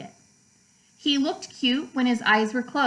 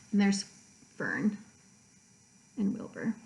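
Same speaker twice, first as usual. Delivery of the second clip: quiet aside; 3 semitones lower, 6 dB quieter than usual. Real, not acted. Aside while showing book picture.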